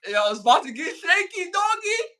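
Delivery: mock excitement, climbing into a high squeal; hyped-up quote. Podcast host acting out a hyped Mighty Ducks player, excited.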